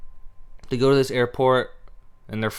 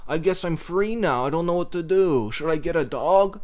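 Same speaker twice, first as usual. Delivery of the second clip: high, mopey mock lament; mocking whiny heroine; 4 semitones higher than usual. Mocking the movie's sad family talk, high mopey whine. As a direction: ham up the self-pity to mock it. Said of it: "these characters are like".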